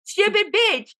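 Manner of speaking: high-pitched exasperated disbelief; scandalised, high and emphatic; incredulous rant. Real, not acted. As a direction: rant upward in comic outrage. Incredulous rant about oblivious woman.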